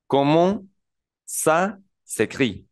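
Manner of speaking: slow "Comment ça s'écrit?" with nasal ending; slow French demo. Shown as a demonstration. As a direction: model "Comment" slowly then "ça s'écrit". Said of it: "Entonces, un". (pronunciación lenta en francés)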